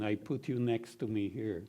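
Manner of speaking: ordinary delivery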